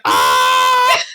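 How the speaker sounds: high, loud shriek; train scream. Acted. Player voices the train shrieking as it snakes around, high and loud. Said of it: "be like".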